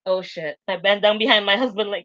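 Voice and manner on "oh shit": hushed oh shit. Retold or imitated. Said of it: "I go".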